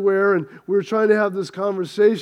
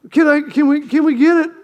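higher and louder, begging for a yes; eager pleading; 6 semitones higher, 4 dB louder, faster than usual. Acted. Wife begging her husband for the house, eager pleading. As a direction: push the ask up eagerly like a kid.

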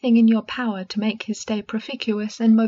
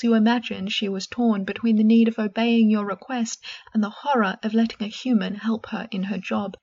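ordinary delivery, nothing marked

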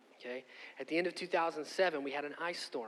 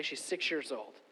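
usual speaking voice

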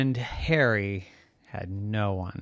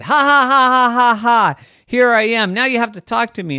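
loud, high mock-evil laugh and boast; triumphant villain; 11 semitones higher, 8 dB louder than usual. Harry the wind gloating at trapped people, triumphant. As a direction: crow over them with a big stagey cackle.

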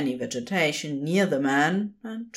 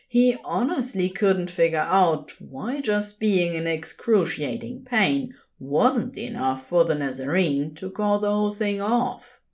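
ordinary delivery, nothing marked

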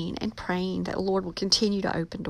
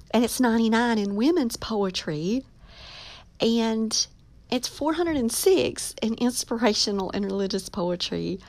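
normal talking voice

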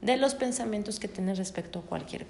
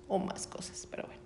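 whispered aside; 7 dB quieter, faster than usual. Real, not acted. Whispered aside after the list.

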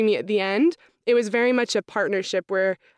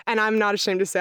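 normal talking voice

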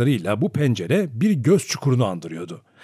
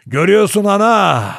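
dreadful wail; 8 semitones higher, 8 dB louder than usual. A narrator's reading. Grandfather crying out to grandmother, a dreadful wail. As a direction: wail it out at full voice. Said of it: "diye inledi büyük babam korkunç bir sesle".

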